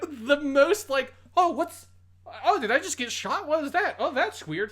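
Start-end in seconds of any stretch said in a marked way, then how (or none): 1.4-4.7 high, loud, oddly casual surprise; underplayed reaction. Podcast host mocking the dub's casual death reaction, high. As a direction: shrug off being shot, high-pitched. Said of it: "the most underplayed reaction".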